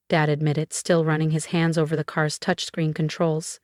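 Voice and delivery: plain reading voice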